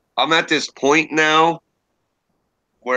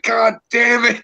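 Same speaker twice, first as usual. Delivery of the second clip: exasperated outburst; 10 semitones higher, 3 dB louder than usual. Retold or imitated. Hearing own forced narration again.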